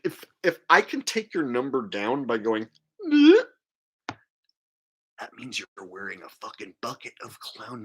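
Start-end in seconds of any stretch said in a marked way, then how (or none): 3.1-3.4 mock bleh. Mock ugly face noise.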